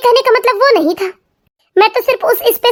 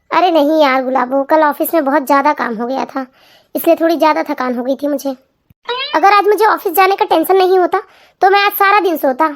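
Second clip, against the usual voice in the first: lower, weary man's voice; tired husband; 5 semitones lower than usual. Acted. Husband telling wife he is worn out, weary voice. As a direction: sound worn out from work.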